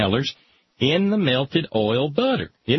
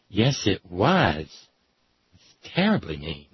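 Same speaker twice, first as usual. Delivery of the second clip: grave mother; 4 semitones lower than usual. Pretend. Mother answering child gravely.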